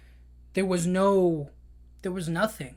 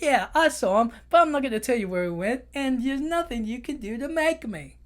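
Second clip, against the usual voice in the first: high, smug crab refusing to talk; defiant crab voice; 6 semitones higher, 3 dB louder than usual. Retold or imitated. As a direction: taunt like a cocky little crab. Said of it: "He's like".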